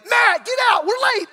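high frantic yell at the roommate; waking a roommate with a panicked high yell; panicked scream. Retold or imitated. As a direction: shout it in a panic, voice jumping way up. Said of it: "Screamed at my roommate".

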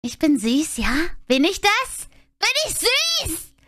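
high, flustered squealing denial; cute anime streamer. Real, not acted. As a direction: squeal it out, embarrassed.

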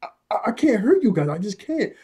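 high, stuttering, breaking plea; can barely get the words out; stammering anguished plea. Retold or imitated. Monomura stammering to his friends, high and anguished. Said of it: "he was like".

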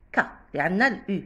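ordinary delivery

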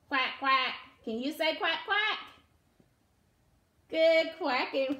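ordinary delivery, nothing marked

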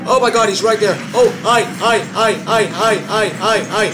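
loud, high, terrified screaming; panicking gamer. Real, not acted. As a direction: shriek it while being chased. Chased by monster in game.

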